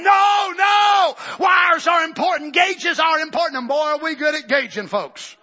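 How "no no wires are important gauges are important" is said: high, loud mock protest; hammed-up loud no no; mock shouted insistence. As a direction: shout it like a scolding voice. God's voice quoted mockingly.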